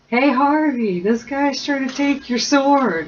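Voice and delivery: high, loud shout across to Harvey; loud call for help. Acted. Stuck in a dragon, calling out to Harvey, high and loud. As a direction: yell for Harvey to come help, a bit slow.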